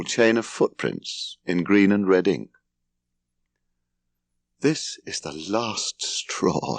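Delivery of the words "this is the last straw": softer and slow, voice shaking with held-in fury; a quiet, slow, shaking declaration. Acted. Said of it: "said Larry in a shaking voice".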